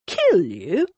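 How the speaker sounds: incredulous, swooping question; shocked. A narrator's reading. Cornelius hearing the murder accusation, shocked. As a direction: echo it in disbelief. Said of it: "eyes almost popped from his head".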